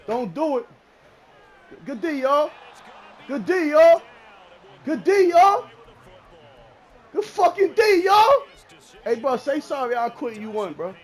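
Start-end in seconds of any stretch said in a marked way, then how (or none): 1.8-8.4 loud cheer building higher; hyped, rising yell; celebratory shout. Real, not acted. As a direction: holler praise at your team, rising each time. Cheering his defense, building.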